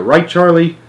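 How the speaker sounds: ordinary delivery, nothing marked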